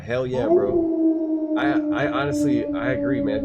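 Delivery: hyped high yell; big excited cheer; excited shout. Real, not acted. Excited reply to chat comment.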